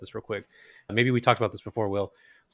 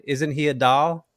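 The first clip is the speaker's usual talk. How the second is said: slow, higher Upper Midwest lilt imitating a nurse; a light Upper Midwest accent impression; Minnesota accent; 4 semitones higher, 4 dB louder, slower than usual. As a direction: stretch it out in a sweet Fargo accent. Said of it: "there was this really beautiful accent".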